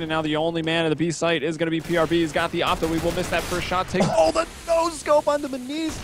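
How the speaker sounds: fast rising play-by-play call; esports caster. Acted. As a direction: caster hyped on a no scope. Live play-by-play of a clutch.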